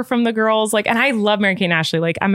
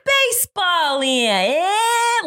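high, drawn-out mock New York accent; hammed-up, terrible New York accent, stretched right out; bad New York accent; 12 semitones higher, much slower than usual. Mocking a child actor's opening line, bad New York accent. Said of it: "starts off with the movie with, like, a New York".

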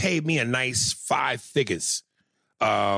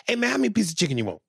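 eager fawning; 6 semitones higher, much faster than usual. Retold or imitated. Caterer suddenly friendly, eager.

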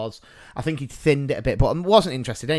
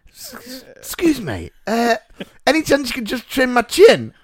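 stammering start, then high and louder; timid courage; 8 semitones higher, 4 dB louder than usual. Retold or imitated. Customer asking the barber for a chin trim, mock timid stammer. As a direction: muster up courage to ask a favour. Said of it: "finally mustered up the courage".

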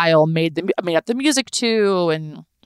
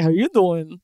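Joey impression. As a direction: lay on the smooth Joey charm. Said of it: "was like".

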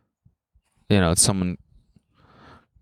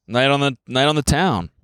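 higher, showy self-hype; "we're still cool" swagger, pitched up; mock excited boasting; 6 semitones higher than usual. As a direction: play a parent thrilled to be out. Acted new parents out on town.

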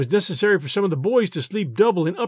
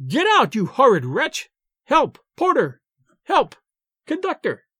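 high-pitched cries for help; alarmed shriek; 6 semitones higher than usual. A narrator's reading. Woman in her berth calls the porter, high alarmed shriek. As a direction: call out in narrated alarm, pitch jumping up. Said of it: "interrupted by a shriek from some woman".

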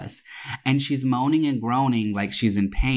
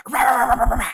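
loud high barking yaps; mock barking; 11 semitones higher, 4 dB louder, much faster than usual. Server barking at the customer, high and loud. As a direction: bark at her like a dog. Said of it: "he goes".

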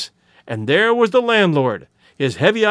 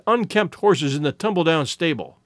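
ordinary delivery

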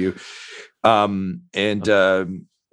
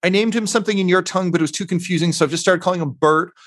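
higher, quick and bright-eyed; eager character voice; 8 semitones higher, faster than usual. Acted. The character explaining the baby's name to her, higher and fast. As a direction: rattle it off, much higher and fast.

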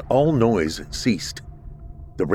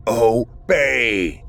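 otherworldly shouted order; screaming command; 3 semitones higher than usual. A narrator's reading. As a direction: blast it at him. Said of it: "the voice screamed with such intensity".

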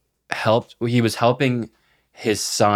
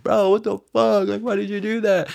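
much higher pitched imitation of an upset kid; mock upset protest; 12 semitones higher than usual. Injured kid protests to his attacker, mock upset. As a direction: whine it high, mocking the hurt boy.

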